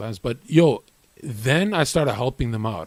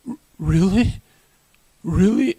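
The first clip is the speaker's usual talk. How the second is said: higher, stammered disbelief; mock nervous; 6 semitones higher, 4 dB louder than usual. Gym veterans start sweating at the newcomer's challenge, mock nervous. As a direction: imitate rattled rivals with a rising, hesitant question. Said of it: "then they start sweating".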